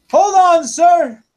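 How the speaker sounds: loud, high holler; high-pitched over-loud request to wait; deaf man's shout. Acted. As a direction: call out far too loudly, like a deaf clerk. Deaf clerk Joe calls out.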